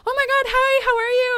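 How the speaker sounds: very high, loud squealed hello; mock-squeal, delighted to see someone; gushing greeting. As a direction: imitate an excited friend greeting. Acting friend's greeting on video call.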